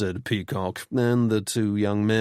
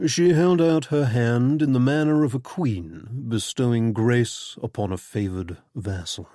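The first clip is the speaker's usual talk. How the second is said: usual speaking voice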